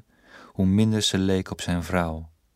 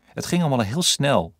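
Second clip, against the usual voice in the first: higher, louder irritated retort; 6 semitones higher, 4 dB louder than usual. Acted. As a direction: snap back, suddenly annoyed. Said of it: "opeens geïrriteerd". (geïrriteerd, hoger en luider)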